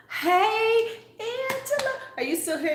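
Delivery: calling hello up high and bright; high greeting voice. Real, not acted. Greeting a live viewer by name, high calling voice.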